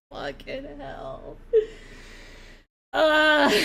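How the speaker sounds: wheezing laugh then groan; cry-laughing; laughing to tears. Real, not acted. Laughing till crying.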